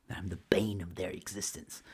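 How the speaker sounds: whispered, low aside; under the breath, mock-grave; hushed aside. Real, not acted. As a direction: drop to a whisper for dramatic effect. Aside mocking the haters' claim.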